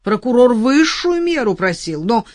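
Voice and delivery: loud, self-satisfied lawyer; pompous lawyer. Acted. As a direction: lecture her smugly about the sentence. Lawyer explains sentence.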